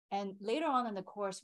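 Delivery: usual speaking voice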